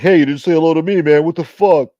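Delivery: loud, indignant complaint; annoyed viewer. Retold or imitated. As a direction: yell it like a snubbed fan. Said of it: "they're gonna be like".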